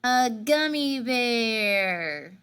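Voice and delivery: slow punchline delivery; telling a dad joke. Acted. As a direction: draw out the punchline slowly.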